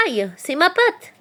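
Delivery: high, drawn-out fox yelp. A narrator's reading. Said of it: "glapit Filou". (glapissement aigu de douleur)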